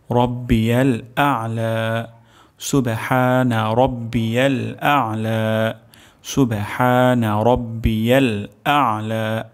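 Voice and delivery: slow, careful recitation with throat আইন in আ'লা; slow correct recitation. Shown as a demonstration. Teacher modelling the full tasbih slowly. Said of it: "আইন উচ্চারণ হবে গলার মাঝখান থেকে".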